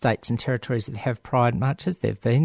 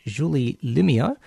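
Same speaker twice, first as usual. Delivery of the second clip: ordinary talk, nothing marked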